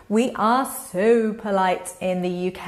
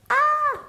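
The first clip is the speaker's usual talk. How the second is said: high squeal of shock; mock shocked gasp. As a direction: gasp in playful horror. Shocked at student's rude order.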